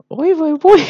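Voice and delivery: high, loud mock wail; exaggerated squeal. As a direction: shriek it like a melodramatic aunt. Repeats exclamation, exaggerated.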